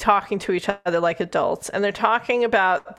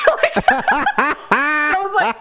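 laughing; 7 semitones higher, 5 dB louder, slower than usual. Real, not acted. Laughing at the library line.

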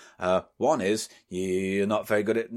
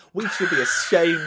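ordinary delivery

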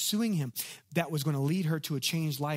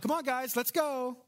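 impatient, much higher hurry-up; not so nice; 9 semitones higher than usual. Retold or imitated. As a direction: hustle the kids along, irritated. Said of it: "in a not so nice way".

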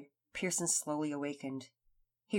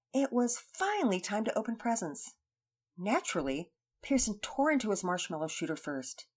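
plain reading voice

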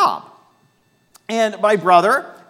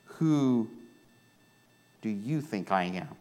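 lower, much softer question; quiet searching question; 6 semitones lower, 9 dB quieter than usual. Acted. Jesus asking his disciples, low and soft. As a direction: ask it gently and low. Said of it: "Jesus looked at his disciples".

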